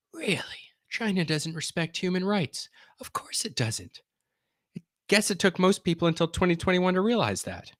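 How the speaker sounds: high-pitched sarcastic disbelief; sarcastic, high mock-shocked voice; mock surprise. Mock surprise at obvious poll finding.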